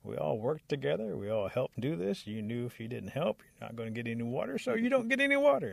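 storyteller's cross bear, telling someone off; indignant scolding. A narrator's reading. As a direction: lay down the law to a shirker. Bear scolds rabbit and refuses water.

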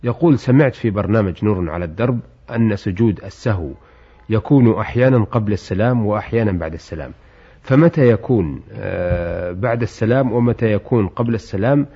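formal standard arabic. Acted. Host reads listener's letter.

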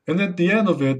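ordinary delivery

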